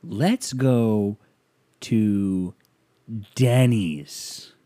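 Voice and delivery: word by word, with heavy pauses; drawn-out, portentous retold invitation; mock dead-serious suggestion. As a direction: imitate a friend pronouncing it with grave weight. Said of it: "looks at me dead in the face".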